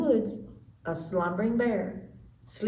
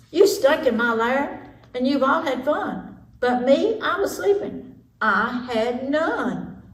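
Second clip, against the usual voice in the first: loud whiny grumbling bear; upset bear; 5 semitones higher, 5 dB louder than usual. Acted. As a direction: complain like a grumpy woken bear. Bear complains after waking.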